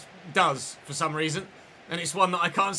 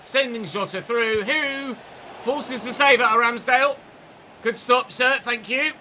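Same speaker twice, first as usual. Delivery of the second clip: excited commentary; 6 semitones higher, 5 dB louder than usual. Real, not acted. Big save in match.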